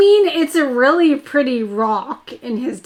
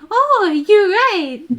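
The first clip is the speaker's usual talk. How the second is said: high, louder girlish mimic; imitating Caroline; 8 semitones higher, 4 dB louder, slower than usual. Fan mimicking Caroline to Neville, high girlish voice. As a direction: chirp it up high and bright like her. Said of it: "when she says to me".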